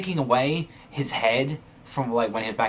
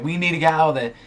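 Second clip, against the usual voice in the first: higher, louder mock plea; retold plea to get out, higher and louder; mock begging; 6 semitones higher, 5 dB louder, faster than usual. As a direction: relay her panicked begging. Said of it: "starts begging".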